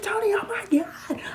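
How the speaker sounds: high, loud panicked wail; hammed-up freakout cry; acted-out breakdown. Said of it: "I start having a breakdown".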